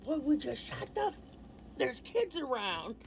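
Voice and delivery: imitating uncle snapping, pitch up; a mock fed-up outburst; a touch of fury at a rude guest; lost it. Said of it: "my uncle just lost it".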